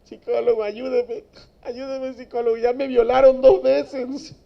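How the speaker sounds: high, loud tearful begging; imitating a crying inmate. Inmate crying to the psychologist for help, high tearful begging. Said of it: "El que está llorando allá adentro".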